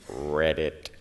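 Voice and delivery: a lightly voiced frog saying read it; frog croak.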